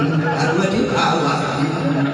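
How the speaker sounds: laughing reading. Retold or imitated. The child reads again, laughing through it. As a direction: chant the lesson while laughing. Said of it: "Baca lagi".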